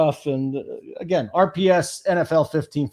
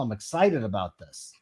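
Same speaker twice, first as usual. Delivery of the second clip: low, soft claim of excitement; unexcited excitement; 6 semitones lower, 5 dB quieter than usual. Real, not acted. Host tells his guest he's keen on NFL, sounding underwhelmed. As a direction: say you're excited with the energy dropped. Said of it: "you sound very excited".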